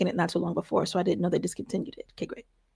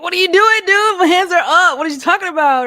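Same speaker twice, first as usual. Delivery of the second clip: loud, higher, indignant "my hands are up"; exasperated protest; 11 semitones higher, 10 dB louder than usual. Retold or imitated. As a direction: plead loudly that your hands are already up. Hands-up standoff with the police officer.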